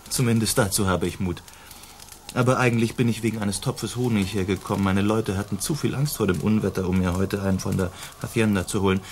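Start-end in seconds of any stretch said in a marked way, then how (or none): none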